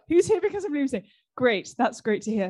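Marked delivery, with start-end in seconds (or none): 0.1-1.0 asked again much higher, with a laugh; eager laughing repeat. Real, not acted. Host re-asks the audience, higher and laughing. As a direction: pitch the question up brightly, laughing.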